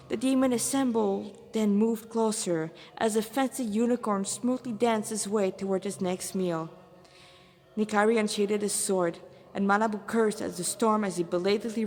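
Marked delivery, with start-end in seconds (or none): none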